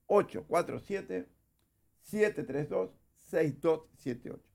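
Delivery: slow, spaced-out digits; slow repeat. Shown as a demonstration. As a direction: read the number out slowly, pausing between groups. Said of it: "Te lo repito despacito".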